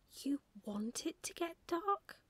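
high, slow, incredulous question; amazed little owl, stretching the words. A narrator's reading. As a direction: ask in amazement, climbing to the end. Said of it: "said Plop in amazement".

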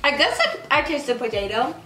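talking between bites; chewing. Real, not acted. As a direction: mouth full of snack. Seen 0: Man bites snack stick, cheeks full, chewing through labelled words.

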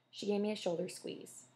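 ordinary delivery

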